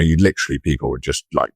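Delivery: normal talking voice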